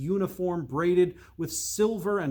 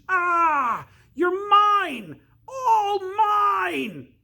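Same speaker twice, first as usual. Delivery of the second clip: very high, loud shrieking claim; shriek of terror; 7 dB louder, much slower than usual. Acted. The enchanter shrieking at the defiant boy. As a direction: shriek it like a wounded demon. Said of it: "A shriek of terror".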